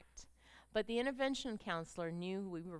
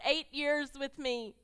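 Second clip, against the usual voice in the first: full-force preaching; 3 semitones higher, 4 dB louder than usual. Acted. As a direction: preach it with everything.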